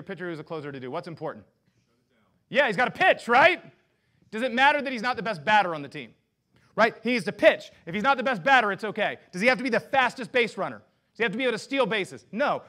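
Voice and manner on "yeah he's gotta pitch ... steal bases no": higher, louder preaching drive; energetic pulpit delivery; full-force preaching. Acted. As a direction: hammer the point home to the congregation. Sports analogy preached loudly.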